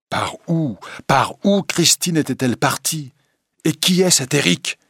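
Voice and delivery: high, loud bewildered outburst; urgent questions rising in pitch and volume; agitated questioning. Acted. Raoul, alone, asks where Christine went, agitated.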